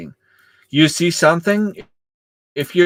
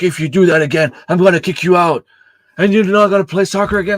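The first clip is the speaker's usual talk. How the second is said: raised, fast mock threat from the referee; imitating angry referee; 5 semitones higher, 3 dB louder, faster than usual. Coach retelling referee threatening a twelve-year-old player, raised mock threat. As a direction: play the referee barking a threat, pitched up and quicker. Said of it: "he's like".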